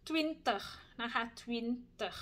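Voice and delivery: twintig slowly, with the i toward an uh sound; Dutch twintig. Shown as a demonstration. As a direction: twintig with a rounded uh vowel. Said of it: "สระอึไว้ก่อนเลยนะคะ". (ออกเสียง twintig สระอึ ปากห่อนิดๆ)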